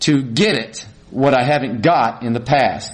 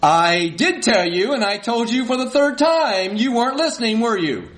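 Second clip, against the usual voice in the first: high-pitched, worked-up retort; acted husband escalating a quarrel; cranked-up argument; 7 semitones higher than usual. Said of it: "Crank up the volume".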